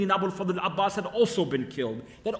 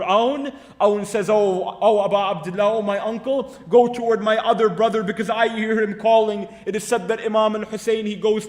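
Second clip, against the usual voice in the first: loud, raised storytelling pitch; impassioned lament preaching; 2 semitones higher, 6 dB louder than usual. A narrator's reading. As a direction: preach the dying nephew's plea at full voice.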